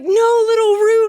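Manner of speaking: long, high drawn-out wail; mock despairing cry, stretched out; falling movie character. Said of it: "when he's falling and he's just like".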